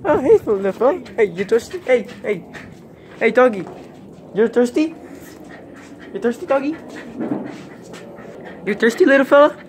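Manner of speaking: high cooing pet voice. Real, not acted. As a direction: coo at the dog. Baby talk to a dog.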